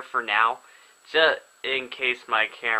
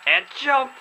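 a sudden pitched-up shout of glee; excited cheer; 4 dB louder than usual. Real, not acted. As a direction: call out the jump way up high. Cheering his snowboarder's jump.

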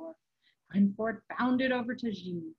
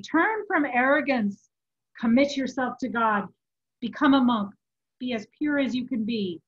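loud exhortation from a tormented soul; urgent warning; 3 semitones higher, 7 dB louder than usual. A narrator's reading. Tormented friend urges a life of purity, urgent warning. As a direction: press each command home forcefully.

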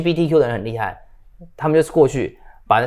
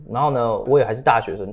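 ordinary talk, nothing marked

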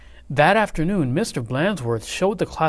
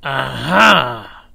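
loud catching-out cry; triumphant aha; 9 dB louder than usual. A narrator's reading. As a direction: pounce on the discovery. Swamp discovering.

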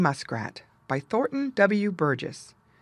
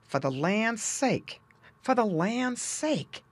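surprised exclaim; 5 semitones higher, slower than usual. Acted. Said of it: "he was so surprised".

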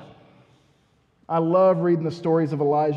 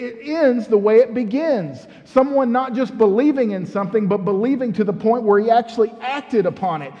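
ordinary talk, nothing marked

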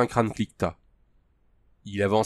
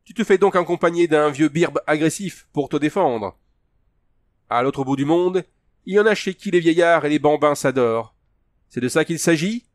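higher, louder jeering; mocking; 6 semitones higher, 5 dB louder than usual. A narrator's reading. Tugar jeers at Andrew's old protector, mocking. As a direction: taunt him with scornful questions. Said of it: "railla le Tugar dans un rire rauque".

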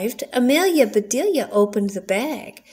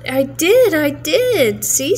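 eager insistence; 5 semitones higher, 4 dB louder than usual. Acted. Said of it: "said Amelia Bedelia".

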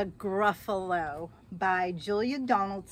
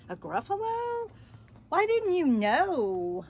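amazed mouse; 8 semitones higher, 5 dB louder than usual. Acted. The mouse telling the fox, amazed he never heard of it. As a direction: sound shocked anyone wouldn't know.

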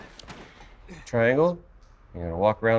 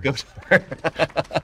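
slow mock voice; 5 semitones higher, much slower than usual. Mimicking game line, teasing.

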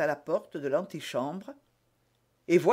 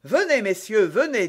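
raised, louder public call; 5 semitones higher, 6 dB louder than usual. A narrator's reading. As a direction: summon the guards for all to hear. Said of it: "dit tout haut d'Artagnan". (à voix haute)

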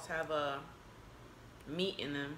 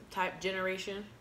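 normal talking voice

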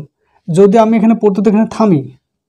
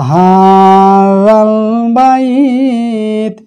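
Quran recitation; 3 semitones higher, much slower than usual. Shown as a demonstration. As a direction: chant it, stretching the long vowels. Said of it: "তাহলে কী বলবো". (জোরে টেনে টেনে তিলাওয়াত)

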